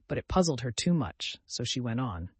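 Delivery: plain reading voice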